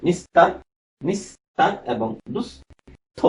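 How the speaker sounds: নিস্তার and দুস্থ split slowly after a hissed s; slow "nis-tar", "dus-tho", s not sh; English-S pronunciation. Shown as a demonstration. Teacher sounding out নিস্তার and দুস্থ for students with English s. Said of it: "তখন ইংরেজি এস এর মতো".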